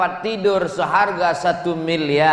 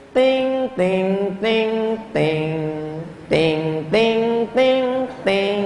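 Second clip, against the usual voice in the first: sung ting-ting like an announcement bell; imitated airport chime; 2 semitones higher, much slower than usual. Preacher mimics the airport announcement chime.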